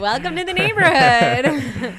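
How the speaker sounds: hammed-up, loud, high welcome through laughter; mock-friendly welcome. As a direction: holler it slowly like an over-friendly neighbor.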